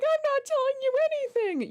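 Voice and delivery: squeaky high stubborn refusal; high, pouty stubborn voice; defiant high voice. Retold or imitated. Voicing a defiant suspect.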